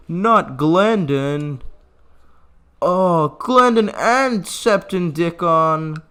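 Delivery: slow, loud hammed-up wail over the dead; milk the mock mourning for each name; mock grief. Gamer to viewers, mock mourning the burned castle's dead. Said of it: "I'm gonna keep doing my bit".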